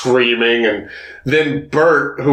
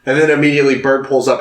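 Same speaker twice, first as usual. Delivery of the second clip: ordinary talk, nothing marked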